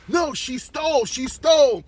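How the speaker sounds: high, loud accusing shout; angry refusal. Acted. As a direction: shout down the pleading crowd. Angry owner refuses the crowd's pleas.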